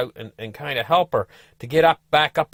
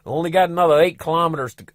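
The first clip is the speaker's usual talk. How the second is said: ordinary delivery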